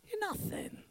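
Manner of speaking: voiceless sinister whisper. Acted. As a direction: hiss it into their ear. Enemy whispers accusation.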